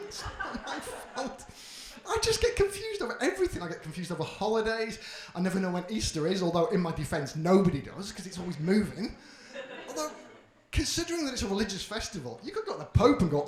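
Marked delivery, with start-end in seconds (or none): none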